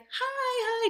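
very high, chirpy hello; over-friendly greeting. Retold or imitated. Gym worker to every member coming in, over-friendly and high. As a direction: greet in a squeaky-high customer voice. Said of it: "you're so friendly".

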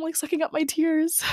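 ordinary talk, nothing marked

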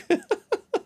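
high giggle after the line; real laugh. Real, not acted. Storyteller amused by his own dog story, high laughing. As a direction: burst into high, helpless chuckles.

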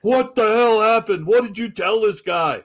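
loud, high, slow angry rant; angry boss. Retold or imitated. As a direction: chew him out. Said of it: "Messina calls me".

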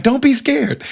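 high and teasing, egging the doctor on; injured friend teases the doctor, high imitation; imitating Julie. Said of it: "she's like".

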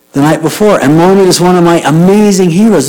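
ordinary talk, nothing marked

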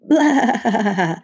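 stuttering bleated "blah-ha-ha"; irritated goat bleat. A narrator's reading. Satyr annoyed at his friend, bleating. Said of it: "more of an irritated bleat".

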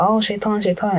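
high-pitched mock whine of blame; mock blaming plea. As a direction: act out people pleading, pitched well up. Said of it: "we're gonna be like".

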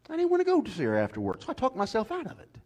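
high, quick, self-mocking confession; preacher mocking his own reluctance, high and fast; sheepish high excuse. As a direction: rattle off the lame excuse. Confessing excuse, pitch jumps high.

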